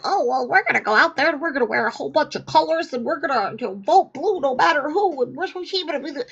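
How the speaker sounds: high, fast, airy protester voice; mock liberal protester. Host mocking vote-blue protesters, high fast airy voice. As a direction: chirp it like a smug activist. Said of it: "They're the same people that go".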